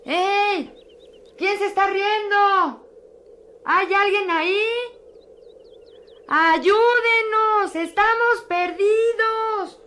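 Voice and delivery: high, loud desperate shouts; calling out for help. Acted. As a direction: yell into the forest for help. (gritos agudos pidiendo ayuda)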